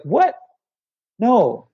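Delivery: high, baffled rejection; incredulous what no. Retold or imitated. Client hears a paraphrase, baffled high rejection. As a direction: recoil as if the word were wrong.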